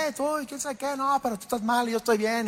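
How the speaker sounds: mock loud family talk. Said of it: "en una casa en donde nos hablamos, pues, fuerte".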